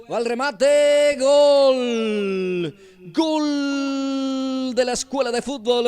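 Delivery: high, loud, stretched goal call; goal shout. Real, not acted. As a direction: roar the goal out long. Goal scored in commentary.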